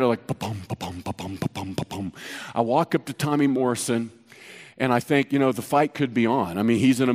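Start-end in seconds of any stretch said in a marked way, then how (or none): 0.0-2.1 much lower, much softer pounding heartbeat. Retold or imitated. A scared cop walking up to a boxer, low hushed heartbeat thumps. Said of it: "I'm, like, way scared".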